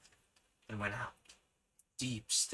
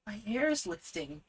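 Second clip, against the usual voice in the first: excited; 10 semitones higher than usual. Acted. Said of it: "Judy exclaimed".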